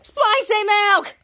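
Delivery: high, loud, drawn-out call; hammed-up gotcha shout; gleeful prank taunt. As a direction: crow it slowly and loudly. Kids jeer after prank.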